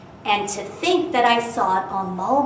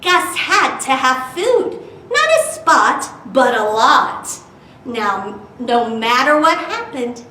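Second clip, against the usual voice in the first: lively storytime narration with bouncy rhyme; animated read-aloud voice; reading to children; 2 semitones higher, 3 dB louder than usual. A narrator's reading. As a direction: read it like a picture book to kids.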